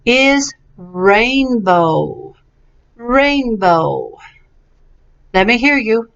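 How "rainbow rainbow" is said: "rainbow" slowly, both syllables stretched; pronunciation model. Shown as a demonstration. Teacher checks pronunciation, slow careful word. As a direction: draw out "rain" and "bow" evenly. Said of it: "make sure we have the pronunciation right".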